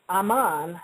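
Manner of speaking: ah-MON, open second syllable; full open vowel ending Ammon. Shown as a demonstration. Variant pronunciations of one god's name.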